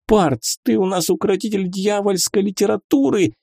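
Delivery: high urgent call to the wizard; shouting. A narrator's reading. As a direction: yell to wake him up. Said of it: "кричала она".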